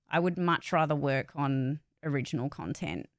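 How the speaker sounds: ordinary talk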